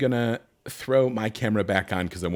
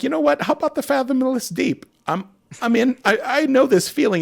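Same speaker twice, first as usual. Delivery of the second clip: acting out own choice. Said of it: "I'm like".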